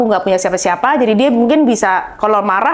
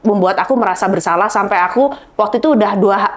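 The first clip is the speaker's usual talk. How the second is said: ordinary talk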